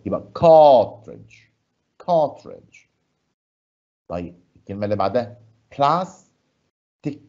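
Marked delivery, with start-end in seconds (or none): 0.4-2.8 "cartridge" with its first syllable pushed up and strong; stress on CAR. Shown as a demonstration. Teacher demonstrates the stress in "cartridge" to the class. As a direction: hit the start of "cartridge", keep the end light. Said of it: "المقطع الأولاني هو إيه؟ أقوى".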